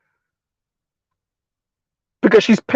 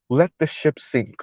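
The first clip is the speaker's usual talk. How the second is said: soft mimicry; 5 semitones lower, 9 dB quieter than usual. Mimics the young lady's soft delivery.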